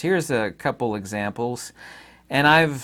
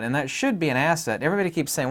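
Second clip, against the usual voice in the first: ordinary talk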